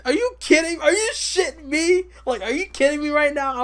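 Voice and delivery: high, loud exasperated yell; shrill-free high shout of disbelief, hammed-up; outraged disbelief. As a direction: blow up at the ref in mock outrage. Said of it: "I'm like".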